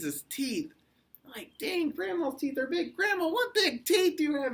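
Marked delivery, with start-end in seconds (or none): 1.6-4.5 very high falsetto exclamation; hammed-up high-pitched amazement; amazed little girl. A narrator's reading. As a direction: blurt it in a squeaky child voice. Girl alarmed by the teeth.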